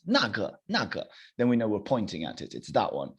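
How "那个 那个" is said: 那 in 那个 with a falling tone; fourth tone. Shown as a demonstration. Teacher showing "that one" to class, falling fourth tone on 那. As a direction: point it out with a falling 那个. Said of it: "a fourth tone, then it's".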